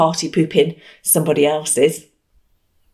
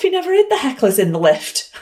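giggling through the words; real amused laughter, higher; laughing; 8 semitones higher, faster than usual. Real, not acted. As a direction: laugh at the punchline. Laughs at the twist.

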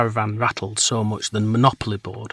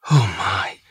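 breathless; 2 semitones lower, 5 dB louder than usual. A narrator's reading. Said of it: "she said breathlessly".

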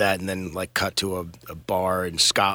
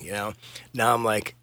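ordinary delivery

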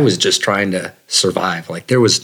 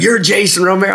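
excited; 9 semitones higher than usual. Retold or imitated. Veteran recognizing the runner, high excited exclamation. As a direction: burst out with recognition. Stranger gushing in bear hug.

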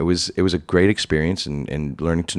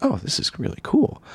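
delighted. Retold or imitated. Delight after two-hundred-dollar day.

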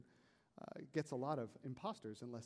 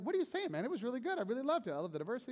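lightly voiced wife, higher and louder in protest; friend's wife protesting; 9 semitones higher, 7 dB louder, faster than usual. Friend's wife defending the service, mild indignant protest. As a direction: toss it back like an indignant friend.

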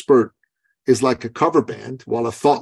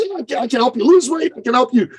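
imitating the eager client, pitched higher and quicker; eager trainer; 11 semitones higher than usual. Client pitching himself to his coach, eager and high. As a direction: play the keen trainer selling to everyone.

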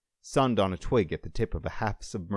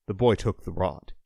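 ordinary delivery

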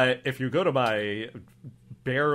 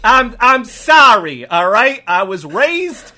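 put-on accented officer recounting old teasing; mock-pitiful improv cop backstory; wounded cop; 8 semitones higher, 10 dB louder than usual. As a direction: confess it as a touchy local cop. Cop tells childhood hurt.

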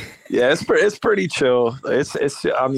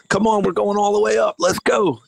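calling out; 9 semitones higher than usual. Retold or imitated. Tour guide quoted in a story.

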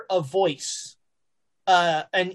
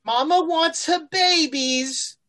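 high, slow British monster mother; British-accented creature voice; 8 semitones higher, slower than usual. Acted. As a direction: draw it out up high as a possessive British mama. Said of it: "A British accent out here".

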